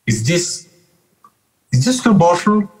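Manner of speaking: put-on high-pitched German accent. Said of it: "let me get my German accent".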